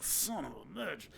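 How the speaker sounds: slow, soft, winded curse under the breath; mild mock wince after a body shot; pained curse. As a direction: groan it out like you just got hit in the ribs. And I was like.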